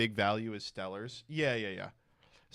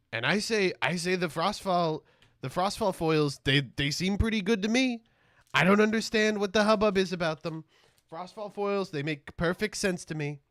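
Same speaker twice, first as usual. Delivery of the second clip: higher, louder mock-indignant insistence; raised, hammed-up self-righteous argument; defiant rant; 8 semitones higher, 5 dB louder than usual. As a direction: stand your ground like a stubborn player. Mock-defiant rant about foils.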